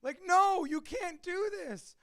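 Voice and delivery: high, loud protest at dad, easing off; retold pleading shout. Son pleading with his dad at the bar, loud and high. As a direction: yell it up high to stop him. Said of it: "I'm like".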